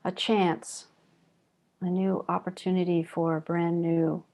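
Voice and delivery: calm, even meditation voice with long pauses; guided meditation. Real, not acted. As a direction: guide them gently inward, leaving long silences between phrases. Leads guided breathing meditation.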